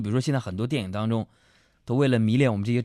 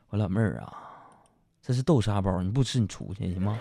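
low, softer, weary deadpan; helpless resignation; 6 semitones lower, 4 dB quieter than usual. Retold or imitated. Embarrassed man to his cousin over bean-paste buns, low resigned plea. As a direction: drop it low, as if giving up. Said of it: "我特别无奈".

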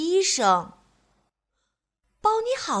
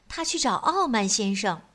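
ordinary delivery

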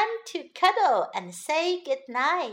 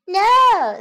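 little bunny; 8 semitones higher, 11 dB louder than usual. A narrator's reading. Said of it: "said little Bunny".

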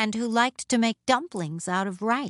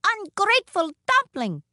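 high-pitched little scold; comic high-voiced telling-off; 12 semitones higher, 4 dB louder than usual. A narrator's reading. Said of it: "scolded the little woman".

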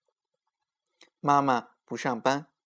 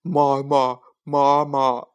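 louder worried call for mom; lost boy calling out louder; anxious calling; 6 dB louder, slower than usual. A narrator's reading. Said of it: "小明急得直叫".

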